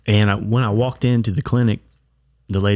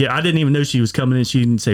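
ordinary delivery

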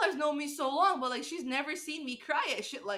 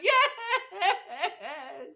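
laughing yes; 6 semitones higher than usual. Retold or imitated. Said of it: "I'm like".

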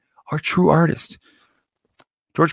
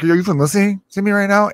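higher, fast, fake-offended whine; mock indignation; 7 semitones higher, faster than usual. Mocking a texter who feels ignored, high indignant whine. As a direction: play the put-out texter demanding attention.

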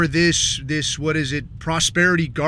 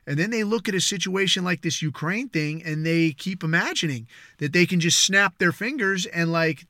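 usual speaking voice

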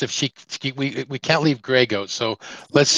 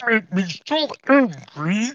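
higher, slow cartoon Martian voice; Marvin the Martian; 6 semitones higher, slower than usual. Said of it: "the Martian from Bugs Bunny".

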